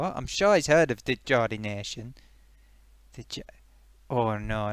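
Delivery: usual speaking voice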